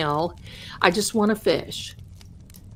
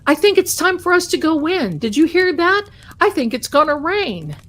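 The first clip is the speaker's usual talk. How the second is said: higher, louder mock Amishman; Amishman's warning; 6 semitones higher, 6 dB louder than usual. Amishman tells grandpa the rain is coming, raised mock voice. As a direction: play the Amishman, pitched up and loud. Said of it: "looks at my grandpa and says".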